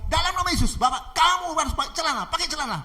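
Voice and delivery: high, loud forceful order; commanding. Acted. Girl ordering her attacker to put trousers on, commanding. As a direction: ring out the command.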